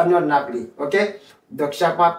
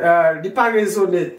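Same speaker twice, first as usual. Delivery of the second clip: ordinary delivery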